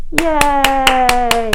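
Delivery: high, bright cheer. Real, not acted. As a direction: celebrate the end. Cheering after the story.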